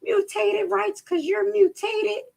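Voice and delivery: high, slow mocking pitch; mock bill-pusher. Host mimicking how they slip in a little bill, mocking high voice. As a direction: sell it in a high, drawn-out mock voice. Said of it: "They put a little bill like".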